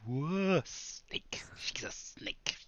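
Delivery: hissed, breathy snake whisper; mock snake whisper to scare the frog; snaky whisper. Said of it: "I'm gonna do a".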